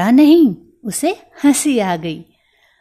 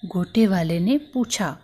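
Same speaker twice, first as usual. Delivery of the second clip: usual speaking voice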